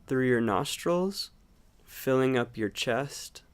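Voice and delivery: calm meditation guide, long unhurried pauses; guided meditation. Acted. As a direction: gently guide them into watching the breath, leaving space between phrases. Leads a breathing meditation.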